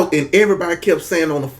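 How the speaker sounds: normal talking voice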